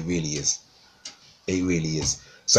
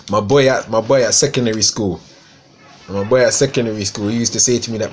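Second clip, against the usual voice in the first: ordinary talk, nothing marked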